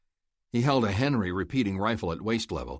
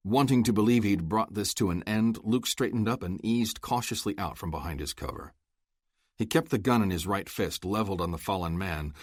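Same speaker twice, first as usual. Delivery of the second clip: usual speaking voice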